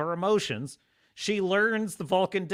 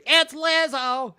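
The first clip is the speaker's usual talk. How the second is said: higher, louder, slow mock cheer; mock celebration; 9 semitones higher, 6 dB louder, slower than usual. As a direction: cheer it out like a fan. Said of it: "Now they celebrate".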